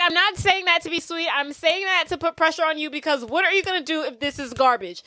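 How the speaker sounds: emphatic mock reprimand; mock pressuring boss. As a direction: put the pressure on, imitating an impatient boss. Acts boss pressuring a nervous employee.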